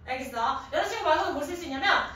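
normal talking voice

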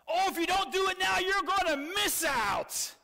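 high, exaggerated pressure pitch; pushy urging. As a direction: goad someone with a hammed-up last-chance warning. Mimics pushy high-pressure sales lines.

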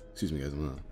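ordinary delivery

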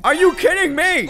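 loud high yell of disbelief; outraged yell. Real, not acted. Player yelling at the game as the fish escapes, loud outrage. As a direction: shout it at the screen, fed up.

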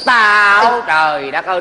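kéo dài tiếng kêu trời, to giọng; kêu trời vì bực mình kiểu hài. Acted. Than phiền bạn khóc quá lớn. (than trời, kéo dài giọng)

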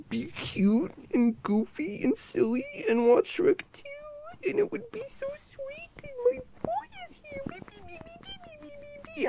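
put-on very high, soft squeaky baby voice, breaking into tiny wees; cutesy squeak. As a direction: coo it like a squeaky toy. Cooing baby talk to boyfriend.

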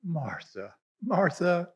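Jesus answering Martha softly and lovingly; loving reply. Shown as a demonstration. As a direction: say "Martha, Martha." gently with love. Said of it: "he said / I read this as a loving reply".

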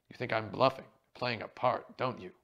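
loud, dramatic challenge; mimicked stage actor. A narrator's reading. Inspector re-enacting a threat from the play, loud mimicry. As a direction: throw down the accusation like a stage villain. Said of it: "You're a darn good mimic".

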